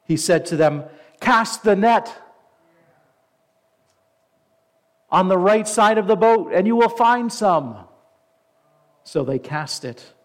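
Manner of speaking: deliberate, emphatic pulpit reading with pauses; scripture reading. A narrator's reading. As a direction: read the verse out to the whole congregation. Reads scripture aloud to congregation.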